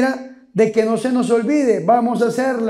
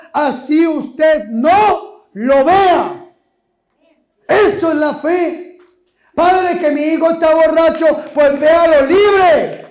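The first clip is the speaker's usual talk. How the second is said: fiery preaching; 4 semitones higher than usual. Acted. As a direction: preach it with full force to the crowd. Preaching at full force. (predicación fervorosa y a todo pulmón)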